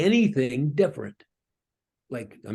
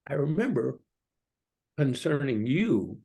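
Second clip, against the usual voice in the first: God asking gently; 3 semitones higher than usual. Acted. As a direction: ask it like a hurt lover. God speaking, tender and hushed.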